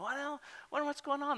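high, soft wondering voice; curious bystander. Acted. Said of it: "there's some people on the, on bystanders".

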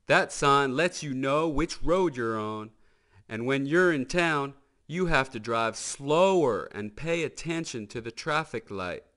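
patient folksy tow truck; Mater's voice. Acted. Mater explains the sign to the hot rods, folksy. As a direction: explain the rules like a friendly country deputy.

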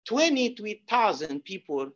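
ordinary delivery

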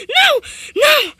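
very loud, high screams of no; anguished shout. Real, not acted. Gamer screaming as the character falls to death. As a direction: scream it out in disbelief.

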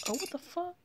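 shocked. Real, not acted. Shocked reaction to the game scene.

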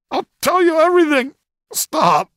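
tortured prisoner crying for it to stop; crying in agony. Acted. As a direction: scream out the surrender through tears. Prisoner screaming under harder torture.

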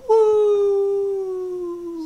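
very high, loud falling howl. A narrator's reading. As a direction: Coyote howls as he plunges down. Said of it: "he howled".

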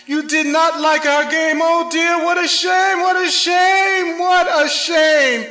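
hammed-up sigh of disappointment; mock sad cat. A narrator's reading. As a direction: moan it like a sulky cat. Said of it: "said the cat".

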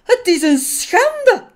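very high, loud shriek of indignation; furious judge shouting at the top of his range; high-pitched outraged cry. Acted. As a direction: explode in shrill outrage. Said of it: "riep hij op hoge toon".

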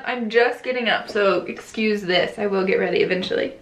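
usual speaking voice

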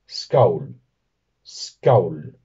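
skaul with a gliding au vowel; Icelandic pronunciation. Shown as a demonstration. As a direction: glide from a to u in skaul, falling. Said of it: "en islandés".

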